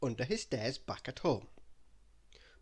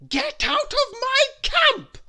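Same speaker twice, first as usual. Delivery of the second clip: much higher, louder, slow bossy shout; Little Miss Bossy chasing off the bear, shouting; roaring back; 13 dB louder, slower than usual. Acted. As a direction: roar it back even bigger. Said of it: "Little Miss Bossy roared back".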